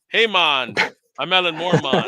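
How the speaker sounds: put-on a goofy Jamaican-accented Alan Moore, playful; loud mock Jamaican patois. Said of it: "everything I do sounds, uh, Jamaican".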